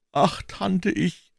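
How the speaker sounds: high, slow feeble whimper; hammed-up suffering boy; feigned agony.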